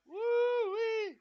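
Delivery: high squealing woo-wee; excited whoop. Real, not acted. To stream viewers before kickoff, a high gleeful whoop. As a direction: let out a gleeful hoot.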